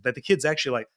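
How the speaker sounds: ordinary talk, nothing marked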